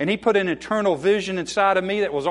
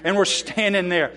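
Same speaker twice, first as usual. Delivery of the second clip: prayer; 2 semitones higher, 4 dB louder than usual. Real, not acted. Leads a prayer.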